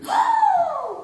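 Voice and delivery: very high offended shout; shocked gasp. Acted. Jules reacts to the insult with a very high shout. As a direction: yelp in outrage.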